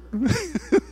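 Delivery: high burst of laughter. Retold or imitated. Man knocked down laughs to God, high laugh.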